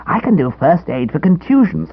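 high, eager schoolboy voice; cheeky boy scout. A narrator's reading. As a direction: play the smug little scout, pleased with himself. Narrator voices Edwin.

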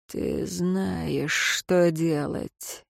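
eerie, drawn-out undertone; hushed prompting. A narrator's reading. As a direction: murmur it like a secret instruction.

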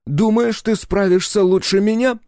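very high, loud, indignant challenge; affronted, voice shooting up; rising to the challenge. A narrator's reading. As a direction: take up the dare at high pitch. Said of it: "принял вызов Сальф".

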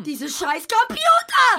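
very loud, slow cursing outburst; furious shout. Acted. In the absurd office sketch, he curses the computers in fury. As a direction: rage at the machines, drawn out.

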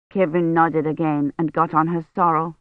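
ordinary delivery, nothing marked

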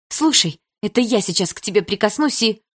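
high, heated threat; indignant. A narrator's reading. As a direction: burst out, about to explode. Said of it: "едва не взорвалась от возмущения".

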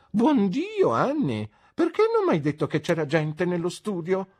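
loud and high, out of breath; breathless cry. A narrator's reading. Mother bursts in crying out to her daughter, breathless. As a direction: burst in gasping with indignation. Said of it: "gridò ansimante".

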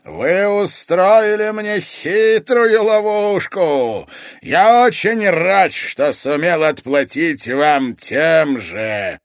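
slow, loud, gloating oracle; mad sorcerer. A narrator's reading. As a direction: gloat at full volume, drawing words out. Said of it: "колдун разразился безумным смехом".

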